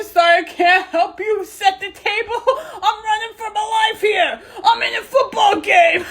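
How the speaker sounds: high giggling shouts; laughing. Real, not acted. Kid calling mom over about silly filters, high giggling. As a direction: laugh through it, can't stop.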